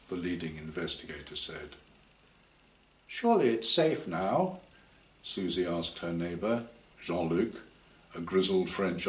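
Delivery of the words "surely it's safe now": anxious question. Acted. Young woman asking anxiously.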